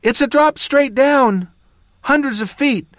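high, urgent shouted report; shouting back. A narrator's reading. Jas reports the sheer drop to the mage, shouting back. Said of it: "Jas shouted back".

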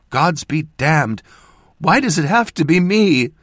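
high, loud anguished outburst; shuddering sob. A narrator's reading. Mother breaking down over losing her child, anguished sob. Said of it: "she said with a shuddering sob".

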